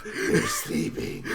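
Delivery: breathy laughing whisper, slow; mock whisper. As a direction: whisper it like not waking anyone. Playing along, shushing joke.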